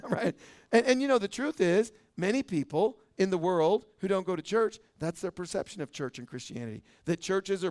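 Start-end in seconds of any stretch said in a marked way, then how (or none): none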